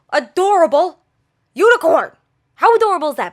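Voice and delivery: loud, high, punched-out delight; gushing. Real, not acted. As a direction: gush over it, word by word. Gushing over a cute toy.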